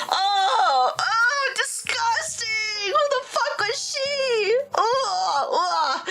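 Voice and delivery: high, drawn-out cringing wail; squealing, theatrical revulsion; mock disgust.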